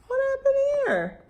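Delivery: ordinary delivery, nothing marked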